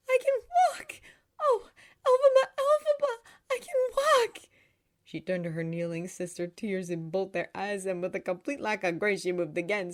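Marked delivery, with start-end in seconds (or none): 0.1-4.5 overjoyed, tearful high cry; Nessa's joyful cry. A narrator's reading. Girl tells her sister she can walk, joyful cry. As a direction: shout it high, bursting with joy. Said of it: "she cried, beaming".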